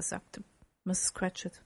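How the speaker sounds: ordinary delivery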